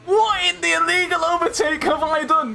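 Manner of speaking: high, indignant complaint; exasperated protest. Real, not acted. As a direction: whine it high in disbelief. Protesting penalty.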